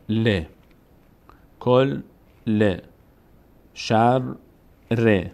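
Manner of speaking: ل as "le", then کل، ل and شر، ر in separate beats; split syllables. Shown as a demonstration. Teacher demonstrating tashdid with kasre, syllable by syllable. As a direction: pause before each repeated letter with its vowel. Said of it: "به همراه حرکتی که خود لام داره".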